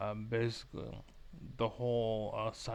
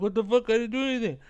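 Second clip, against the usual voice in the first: mock yell, much higher and louder, caught off guard; shocked outburst; 11 semitones higher, 10 dB louder, faster than usual. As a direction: blurt it out like you just got jumped by an ape. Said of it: "you're like".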